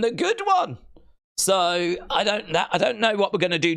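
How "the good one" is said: high squeaky voice on the good one; mock squeak. Talking wrestling to the chat, a jokey high squeak. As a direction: squeak it out for a laugh.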